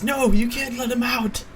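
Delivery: high-pitched, urgent acted cry; frantic father protesting. Priest bursts in to stop adventurers, frantic high cry.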